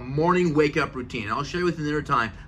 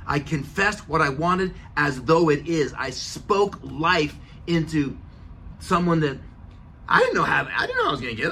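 ordinary delivery

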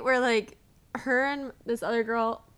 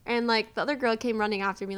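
ordinary talk

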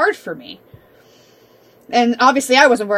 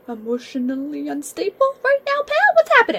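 mocking concern; 5 semitones higher than usual. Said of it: "And I'm like".

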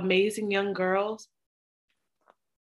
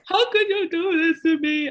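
mock wailing father; 10 semitones higher, 5 dB louder than usual. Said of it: "he bursts into tears".

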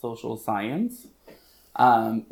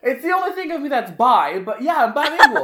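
laughing joke; 12 semitones higher, 6 dB louder than usual. Real, not acted. Joking about being bi.

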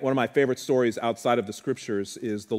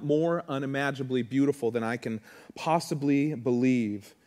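normal talking voice

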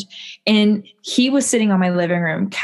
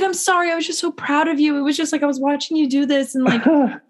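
high apologetic gush; sheepish boyfriend; 8 semitones higher than usual. Retold or imitated. Boyfriend explaining himself to her after the call, high and sheepish. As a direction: plead sweetly that you were just proud. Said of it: "he's like".

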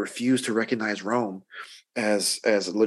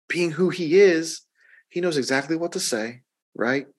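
usual speaking voice

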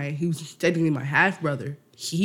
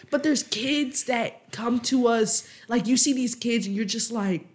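ordinary delivery

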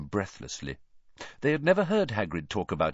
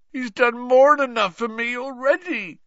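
pained, strained cry, much higher and louder; groaning Hagrid; 8 dB louder than usual. Acted. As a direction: groan it out in misery. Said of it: "groaned Hagrid".

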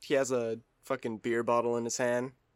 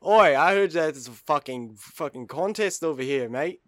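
loud Australian bloke spoiling for a fight; Australian brawler; 2 semitones higher, 8 dB louder than usual. Acted. Average Australian man looking for a fight, loud Australian accent. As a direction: shout it like a drunk Aussie bruiser. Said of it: "He's like".

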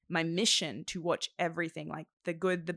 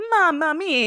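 mock cartoon Italian; 10 semitones higher, 11 dB louder, slower than usual. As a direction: ham it up like a cheap movie Italian. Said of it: "the random Italian man who's like".

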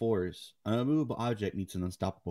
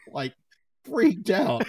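ordinary talk, nothing marked

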